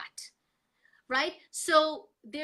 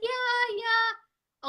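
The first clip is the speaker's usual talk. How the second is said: high sing-song yeah yeah, louder; breezy brush-off; 10 semitones higher, 4 dB louder than usual. Retold or imitated. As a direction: airily sing it off like it's nothing. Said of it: "I'm like".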